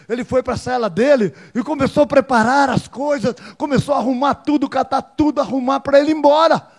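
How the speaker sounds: loud, high, excited preaching; preacher telling the congregation about the prisoner packing, excited; full-force preaching. Acted. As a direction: build the crowd's excitement.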